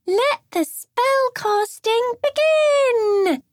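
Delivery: high, slow, booming showman announcement; circus ringmaster. Acted. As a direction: announce it like a big-top ringmaster. Girl playing witch ringmaster.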